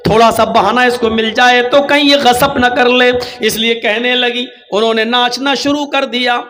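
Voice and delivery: ordinary talk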